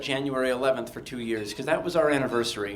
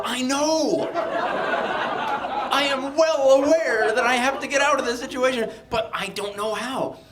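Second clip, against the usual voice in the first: louder, much higher, laughing desperately; laughing plea; 12 semitones higher, 7 dB louder, slower than usual. Retold or imitated. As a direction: laugh through the admission. His own plea retold.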